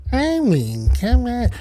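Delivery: weird voice. Pretend. Streamer goofing on his own catchphrase, weird high voice. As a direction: say it high and goofy, vowels bent. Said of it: "I'm being weird".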